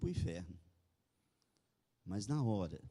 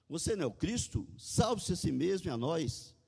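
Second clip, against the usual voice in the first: higher-pitched narrated challenge; mildly insulting; 7 semitones higher than usual. A narrator's reading. Crucified criminal insulting Jesus, mildly taunting in higher pitch. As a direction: throw it out as a restrained taunt. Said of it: "lançava-lhe insultos".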